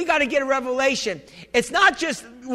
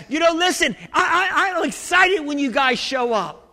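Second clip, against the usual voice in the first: excited preaching; 4 semitones higher than usual. Real, not acted. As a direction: preach it with rising joy.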